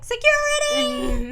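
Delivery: high, drawn-out, loud call; mock calling out. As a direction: imitate calling for help, stretched out high. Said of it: "She starts calling security".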